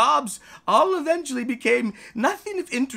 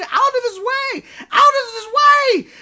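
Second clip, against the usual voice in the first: high, loud yell to clear the way; panicked shout; 7 dB louder than usual. Acted. City people fleeing the charging cow, yelling. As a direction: scream it as people scatter in fear.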